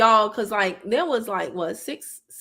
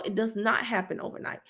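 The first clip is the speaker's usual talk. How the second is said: normal talking voice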